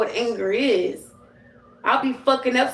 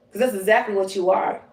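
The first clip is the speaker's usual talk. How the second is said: normal talking voice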